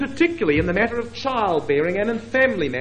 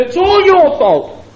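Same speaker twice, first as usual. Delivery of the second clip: higher, louder, slow blaming cry; mock accusation; 11 semitones higher, 7 dB louder, slower than usual. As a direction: fling the blame at him, pointing. Sarai points and blames Abraham.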